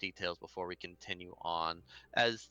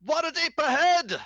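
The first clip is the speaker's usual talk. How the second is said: high, loud lookout shout; shouting from crow's nest; 10 dB louder than usual. Acted. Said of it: "sort of shouts out".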